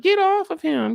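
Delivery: yelling for him to get off; high panicked Ellie. Retold or imitated. Repeated panic line.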